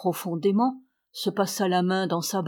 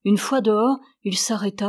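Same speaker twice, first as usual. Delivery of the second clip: ordinary delivery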